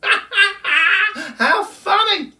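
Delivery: very slow high laughing howl. Acted. Kookaburra laughing at the wombat story, very slow. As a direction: laugh the words out long and loud. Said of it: "laughed Colin".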